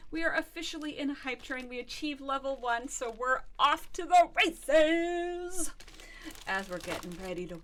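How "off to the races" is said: high, loud, drawn-out cheer; excited cheer. Real, not acted. Streamer celebrating the hype train, excited cheer. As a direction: stretch it into a rising holler.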